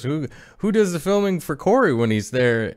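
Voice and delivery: lightly voicing his wife; wife's voice.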